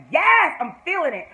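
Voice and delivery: high, loud burst of delight; hyped fan reaction; excited cheer. Retold or imitated. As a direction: relive the thrill with a shout. Retells own excited reaction.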